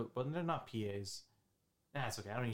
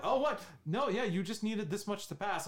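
surprised disbelief; 8 semitones higher, 3 dB louder than usual. Retold or imitated. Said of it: "I was like".

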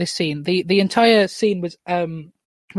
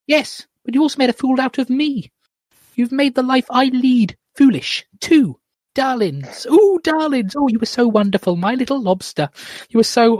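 high-pitched, dramatic film mother; female character voice; 6 semitones higher than usual. Acted. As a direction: swing high from reproach to gushing over the children. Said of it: "do two different voices for this scene".

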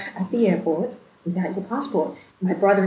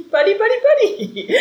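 much higher, louder, laughing through it; laughing disbelief; 14 semitones higher, 6 dB louder than usual. Retold or imitated. Bride hearing the frantic airport call, laughing and high.

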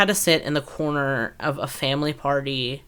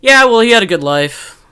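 breezy mock send-off; 11 dB louder than usual. Mocking a casual chat about death, breezy and loud. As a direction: shrug off a death cheerfully. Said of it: "It's not like".

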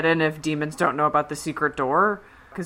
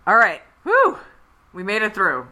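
ordinary talk, nothing marked

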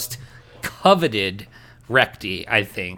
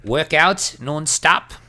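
put-on terrible Australian accent as Bitlord; 3 semitones higher than usual. Host keeps playing Bitlord in a mock Australian accent. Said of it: "great impression".

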